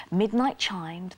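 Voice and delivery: ordinary delivery, nothing marked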